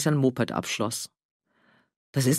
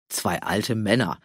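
indulgent scoff; 7 semitones lower, slower than usual. Acted. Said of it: "Walter schüttelte nachsichtig den Kopf".

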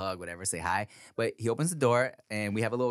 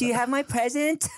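put-on high child's rising question; expectant little girl; 14 semitones higher, 3 dB louder than usual. Daughter wants her promised gift, eager child voice. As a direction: mimic an eager kid asking.

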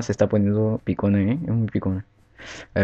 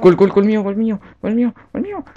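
shrieking high goal cheer; goal celebration; 8 semitones higher, 5 dB louder, slower than usual. Real, not acted. (celebración de gol)

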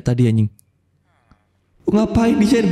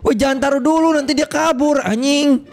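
high-pitched, agitated call; urgent warning; 6 semitones higher than usual. Real, not acted. Player warning friend the animal will escape, urgent warning. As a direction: stop a friend before it goes wrong.

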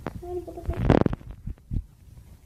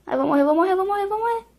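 rising, louder fearful whimper; panicked whine; 7 semitones higher, 6 dB louder than usual. Real, not acted. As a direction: panic as you're caught. Enemy attacking him in game.

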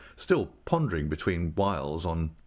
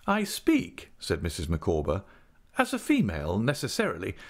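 lightly raised, earnest matronly lady; a hint of a grand, fussing wife; Mrs Micawber voice; 8 semitones higher than usual. A narrator's reading.

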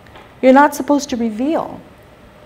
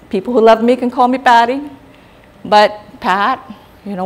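ordinary delivery

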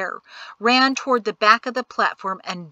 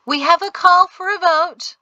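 raised, higher voice straining to carry; trying to sound loud; 9 semitones higher, 6 dB louder than usual. A narrator's reading. Magistrate calling the crowd to vote, loud. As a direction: push it out loud to the whole crowd. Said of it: "Wibble tried to sound as loud as the colonel".

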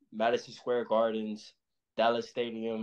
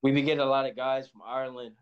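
ordinary talk

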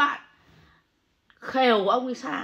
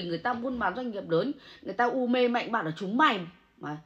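ordinary talk

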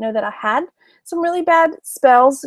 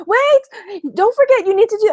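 much higher, fussy warning; high urgent interruption; 10 semitones higher than usual. Retold or imitated. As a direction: hurry to stop someone. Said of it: "and's like".

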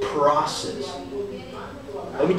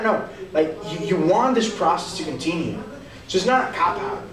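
ordinary talk, nothing marked

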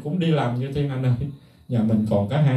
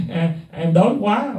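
mock whine; 5 semitones higher, slower than usual. Mocking celebrity asking for food.

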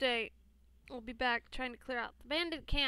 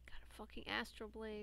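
whispering; 9 dB quieter than usual. Real, not acted. Whispered comment.

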